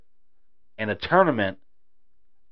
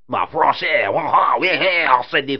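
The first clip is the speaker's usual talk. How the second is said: mock French accent, a bit higher and louder; 5 semitones higher, 5 dB louder than usual. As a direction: say French jokingly, in a hammed-up French accent. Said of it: "accent I just did there".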